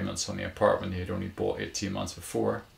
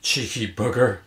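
louder, amused chortle in the words; chortling; 3 semitones higher, 8 dB louder than usual. A narrator's reading. Woman teasing the dog walker back, chortling. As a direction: toss it out with a chortle. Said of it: "she chortled".

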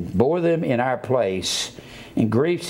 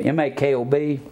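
ordinary delivery

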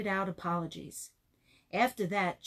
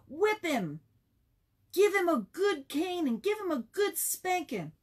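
eager classmates; 9 semitones higher, 3 dB louder than usual. Acted. Class shouting for punishment.

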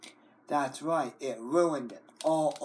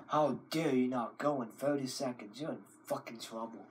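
low threat; 5 semitones lower, 10 dB quieter, faster than usual. Acted. Scolding the computer as Safety Scissors.

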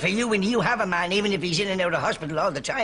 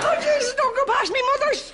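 mock panic; 3 dB louder than usual.

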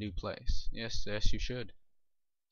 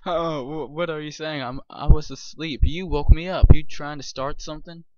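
grumpy neighbor; 3 semitones higher, 9 dB louder than usual. Acted. Voicing the woken neighbor.

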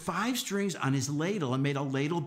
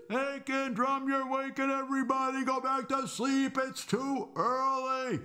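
acted wake-up complaint, high and stretched; yelling; 11 semitones higher than usual. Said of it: "everybody started yelling".

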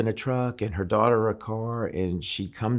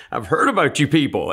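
wary quip; 6 semitones higher, 5 dB louder than usual. Retold or imitated. Reacting to church lady.

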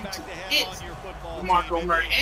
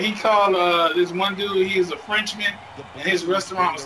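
ordinary talk, nothing marked

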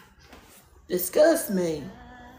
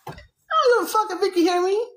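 defiant outburst; 11 semitones higher, faster than usual. Real, not acted. Defiant remark about being heard.

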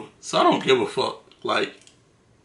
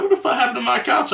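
mock upset owner; 10 semitones higher, faster than usual. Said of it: "she was like".

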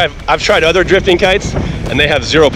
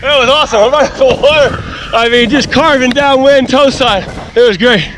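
panting, out of breath after riding; breathless words between breaths; 7 semitones higher than usual. Real, not acted. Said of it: "I'm out of breath".

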